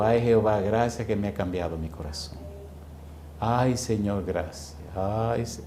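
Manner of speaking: soft, hushed grateful prayer, trailing away; murmured thanks to God. Retold or imitated. Preacher retelling how he thanked the Lord softly. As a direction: breathe the thanks almost under your breath.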